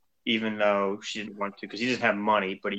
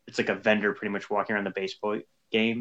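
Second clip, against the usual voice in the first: ordinary delivery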